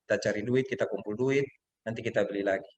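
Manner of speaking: soft, gentle reassurance to a crying son; soothing father. Retold or imitated. Father comforting his crying son over the stolen bike, softly. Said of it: "saya bilang ke dia".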